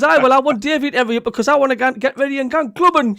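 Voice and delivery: high, loud, fast retort; imitating an impatient woman bickering; Gladys. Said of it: "she says".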